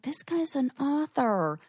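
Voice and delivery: whispered thought. Retold or imitated. Inner thought retold.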